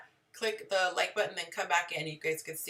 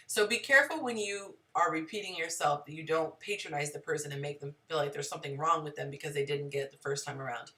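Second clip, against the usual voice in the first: ordinary talk, nothing marked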